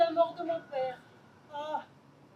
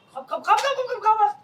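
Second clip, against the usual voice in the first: louder, higher clicking croc; vocal tic; 5 semitones higher, 11 dB louder than usual. Acted. OCD sufferer's vocal tic.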